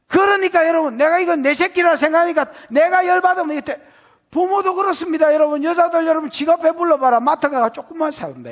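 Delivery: high, loud fiery sermon delivery; full-force preaching. Acted. Pastor rousing the congregation about angry parents, fiery preaching.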